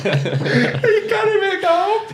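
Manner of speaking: slow, high, cracking with laughter; wheezing laugh through the words; helpless laughter. Real, not acted. Laughing at the footballer's threat, slow and high-pitched.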